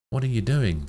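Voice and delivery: loud, high furious shout; doctor shouting. Acted. Doctor shouts at Kaim for holding her hands, loud and furious. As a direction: yell it across the room. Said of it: "the doctor shouts at him".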